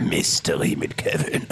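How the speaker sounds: low distorted slow voice; deep warped trailer whisper; voice changer. Shown as a demonstration. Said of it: "so einen Stimmverzerrer und quatsch da rein".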